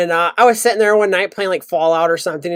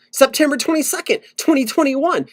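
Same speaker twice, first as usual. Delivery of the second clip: mocking high date; 6 semitones higher than usual. Real, not acted. Mocking the repeated date again.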